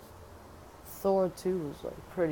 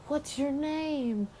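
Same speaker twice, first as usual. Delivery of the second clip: much higher, slow sugary ask; mock lover's question; 9 semitones higher, much slower than usual. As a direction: draw it out like a swoony lover.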